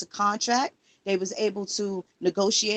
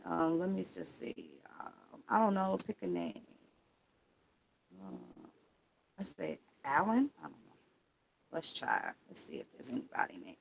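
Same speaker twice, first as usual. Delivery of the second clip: muttering to himself; 4 semitones lower, 11 dB quieter than usual. Real, not acted. Muttering to himself while searching.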